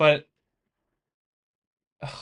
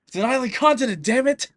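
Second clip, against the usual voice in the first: hammed-up angry yell, much higher and louder; mock aggressive shout; 10 semitones higher, 9 dB louder than usual. Streamer mock-yells at chat about Australia, hammed-up fury. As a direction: blow up in fake fury over the continent. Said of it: "I said that like I was aggressive".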